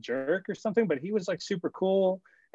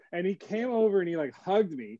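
usual speaking voice

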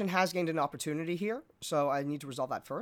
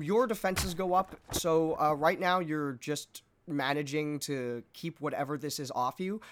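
ordinary talk

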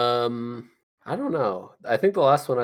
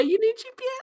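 squeaky high voice; 4 dB quieter, faster than usual. Pretend. Silly high voice bit.

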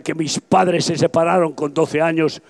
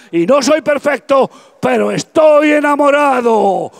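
higher, louder declaration of love; preacher proclaiming he is in love with God, ringing shout; fervent proclamation; 8 semitones higher, 6 dB louder than usual. Real, not acted. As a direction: shout your love for Christ. Climax of testimony, fervent.

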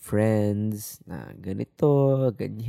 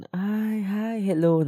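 higher, bright polite "Ay, hi, hello"; awkward greeting; 11 semitones higher, 5 dB louder, much slower than usual. Retold or imitated. Mimicking a college acquaintance's awkward greeting, mock polite. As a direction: play a stiff acquaintance forcing a hello.

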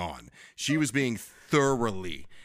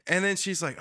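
usual speaking voice

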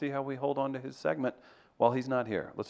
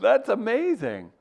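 delighted amazement; 12 semitones higher, 7 dB louder, slower than usual. Real, not acted. Amused reaction.

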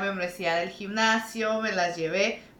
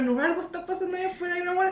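mock panic; 8 semitones higher, much faster than usual. Retelling panicked words to sister.

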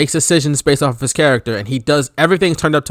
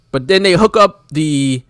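ordinary talk, nothing marked

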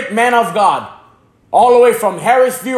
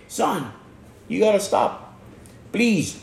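hushed, softer plea from a worried mom; a mother's soft plea to her son; soft pleading mother; 3 semitones lower, 9 dB quieter, slower than usual. Retold or imitated. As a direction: beg gently and quietly like a mother.

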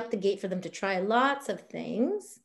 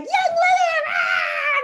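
shrieked, much higher and louder; mock overexcited shout; 8 dB louder, much slower than usual. Coach mocking an oily fanatic scaring people, shrieking. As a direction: shriek the brand name like a fanatic. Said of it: "if you're like".

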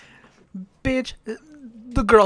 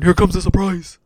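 announcer hype; 6 dB louder than usual. Acted. Hyping a reveal like a host.

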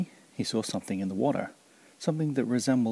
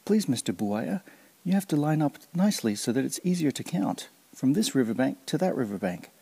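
higher, coaxing politeness; Kancil voice; 5 semitones higher than usual. Acted. Kancil politely coaxing Mister Buaya to line up, higher. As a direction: sweet-talk the crocodile into line.

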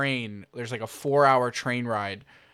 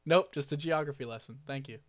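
ordinary talk

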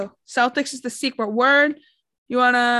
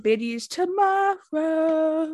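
silly drawl; 5 semitones higher, much slower than usual. Acted. Goofy drawn-out voice.

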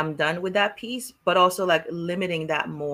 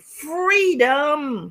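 proclaiming; 9 semitones higher, 4 dB louder than usual. Acted. Proclaiming the theme.

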